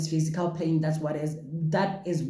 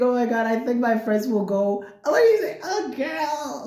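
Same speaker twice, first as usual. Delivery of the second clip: sassy higher friend voice; imitating friends; 6 semitones higher than usual. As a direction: camp up the friends' reaction. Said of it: "I'm laughing because I'm going".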